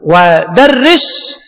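ودرس drawn out slowly; teacher modelling ودرس; slow demo. Shown as a demonstration. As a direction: stretch ودرس. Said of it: "هكذا تقول".

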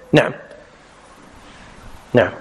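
ordinary talk, nothing marked

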